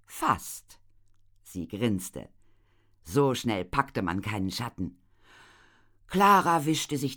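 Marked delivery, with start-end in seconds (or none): none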